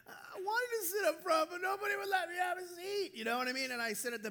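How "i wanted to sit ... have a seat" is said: put-on whiny child protest. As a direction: protest like a wronged child, pitched up. Child protesting to scolding parents.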